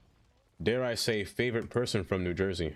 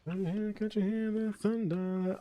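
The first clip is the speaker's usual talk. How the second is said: spooky voice; 10 semitones higher than usual. Acted. Spooky greeting to chat.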